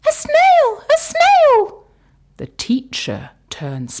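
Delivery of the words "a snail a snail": high, loud startled cries; excited children. Acted. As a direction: shriek in surprise at the snail. Classroom spots the snail.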